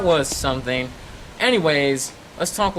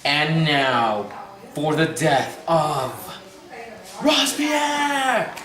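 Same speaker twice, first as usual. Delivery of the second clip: dramatic announcer; much slower than usual. Acted. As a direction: stretch out the name like a host. Host announces execution dramatically.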